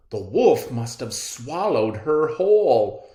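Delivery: loud, urgent hunter voice; alarmed hunter. Acted. Hunter finding the wolf in bed, loud alarmed voice. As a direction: blurt out the horrible realization.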